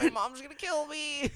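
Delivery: whiny high mock wail over his car; mock panicked driver. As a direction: play the scared kid driver for laughs.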